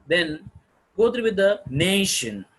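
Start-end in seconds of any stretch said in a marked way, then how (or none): 1.7-2.4 "nation" hit louder as the highlighted part; stressed syllable. Shown as a demonstration. Teacher showing the stressed part of examination, "nation" highlighted. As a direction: punch "nation" with a strong, voiced first syllable. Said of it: "Nation has to be highlighted".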